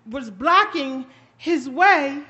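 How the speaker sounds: ordinary delivery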